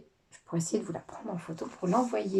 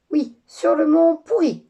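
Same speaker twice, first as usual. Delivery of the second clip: loud, high, slow insistence; insisting father; 8 semitones higher, 9 dB louder than usual. Acted. Father insisting the fairy go to mont Pourri, loudly. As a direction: put your foot down.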